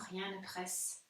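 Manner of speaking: ordinary delivery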